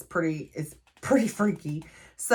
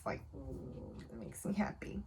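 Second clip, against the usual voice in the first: soft unvoiced whisper, delighted; whispered happy aside; 3 semitones higher, 17 dB quieter than usual. Real, not acted. To viewers about the girls' friendship, whispered. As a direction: breathe it out like a happy secret.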